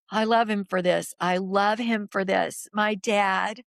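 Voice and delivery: ordinary talk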